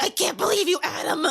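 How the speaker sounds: high, hammed-up girly whine; mock outrage in a high voice; mock girlfriend. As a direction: play the shocked pranked girlfriend.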